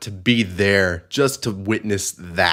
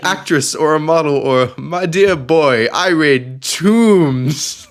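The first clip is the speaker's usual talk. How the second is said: put-on posh British accent; 7 semitones higher, 6 dB louder than usual. Host voicing Lara cosplayer, hammed-up posh British accent. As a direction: drag out my dear boy like a stage Brit. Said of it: "That's the wrong accent".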